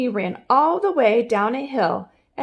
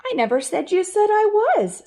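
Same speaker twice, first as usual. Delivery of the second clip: high, raised, indignant; squeaky indignant Homer; 10 semitones higher, 4 dB louder than usual. Acted. Homer arguing with lizard.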